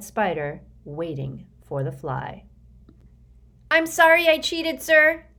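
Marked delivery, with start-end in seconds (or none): 3.7-5.3 blurted out high and loud; frightened boy declaring his guilt; nervous declaration. Acted. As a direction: get the confession out in one breath before courage fails. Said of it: "Sticky declared as he went in".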